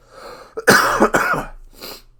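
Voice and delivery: loud, real hacking cough; a real fit of sick coughing. Real, not acted. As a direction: cough hard, several times over. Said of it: "Like I said, still sick".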